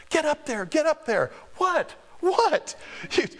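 high, insistent hurried whisper-shout; urgent friends. Retold or imitated. Friends pushing him to stage.

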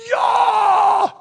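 high, very loud whooping yell; excited scream. Shown as a demonstration. Preacher showing how he reacts to reading scripture, excited scream. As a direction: let out one long, high "Yah!" scream. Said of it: "I scream every time I read it".

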